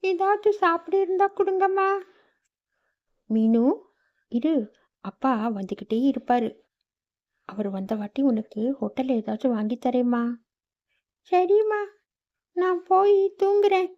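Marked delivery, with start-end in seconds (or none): 0.0-1.9 put-on high, small child's voice begging for food; little girl Meenu. Hungry daughter asking her mother for food, high child voice. 11.3-13.9 put-on high, small child's voice begging for food; little girl Meenu. Hungry daughter asking her mother for food, high child voice.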